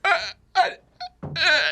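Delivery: high, helpless stammered whimpers; mock terrified whimper. Teller hanging defenseless before the tattooed dude, mock frightened whimper. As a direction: imitate being too scared to speak.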